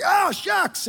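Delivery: high, loud mock outburst; retold frustration. Telling the crowd about missing shots, frustrated outburst. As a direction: yelp out your exasperation, hammed up. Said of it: "I was getting frustrated".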